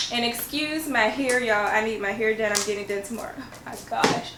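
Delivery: ordinary talk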